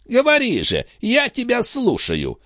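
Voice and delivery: impatient, high-voiced prodding; goading challenge. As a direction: dare him to speak, pitched well up. Abbot goading his opponent to speak.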